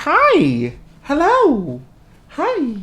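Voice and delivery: high-pitched sing-out hellos, falling; high cutesy greeting. Real, not acted. Greeting someone mid-vlog, high cutesy voice. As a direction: greet in a sweet, high little voice.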